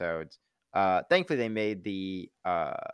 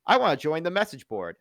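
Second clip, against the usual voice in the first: lightly acted eager newcomer, higher and louder; aspirujący przybysz; 8 semitones higher, 5 dB louder than usual. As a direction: pipe up as someone asking to join. Acting out a newcomer at the camp.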